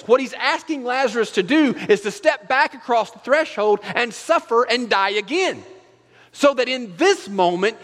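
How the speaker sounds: high, loud impassioned preaching; preaching at full force. Real, not acted. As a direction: drive it home like a revival preacher.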